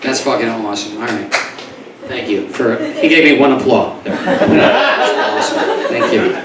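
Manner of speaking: ordinary talk, nothing marked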